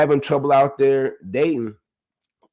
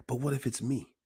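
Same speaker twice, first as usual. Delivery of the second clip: quiet self-doubt; 10 dB quieter than usual. Retold or imitated. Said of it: "repeated self-doubt refrain".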